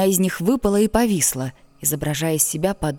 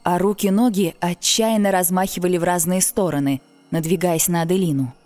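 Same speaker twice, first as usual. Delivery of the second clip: ordinary delivery, nothing marked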